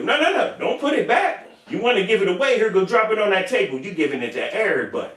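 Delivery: squeaky bossy orders; Crunchy's high scolding. Retold or imitated. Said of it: "And here we go".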